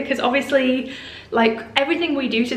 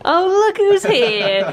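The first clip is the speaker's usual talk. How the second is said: playful greeting; 8 semitones higher, 3 dB louder, slower than usual. Real, not acted. Greeting partner arriving.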